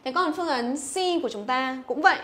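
ordinary talk

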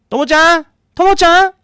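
high, loud desperate calls into the dark; frantic calling. Retold or imitated. Mother searching the riverbank calls for her daughter, frantic high shouts.

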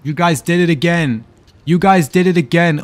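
yelling at chat for tricking him again; exasperated shout. Real, not acted. Streamer yelling at his chat after a trolling name.

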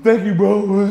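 hammed-up weepy gratitude; crying thanks. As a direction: imitate Troy thanking through tears. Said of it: "my boy Troy just crying".

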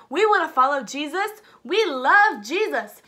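loud, slow declaration; eager crowd. Acted. New followers announcing their faith, loud and slow. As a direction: cheer your devotion. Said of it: "all these people are like".

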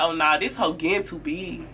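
a grudging private thought; jealous friend thinking to herself; jealous inner thought. Retold or imitated. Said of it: "that hoe like".

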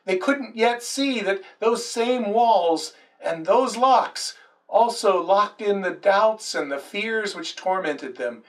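ordinary delivery

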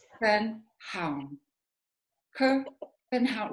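K, ben, haun said with gaps; syllable-by-syllable practice. Shown as a demonstration. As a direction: carefully sound out K, ben, haun separately. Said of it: "Try again".

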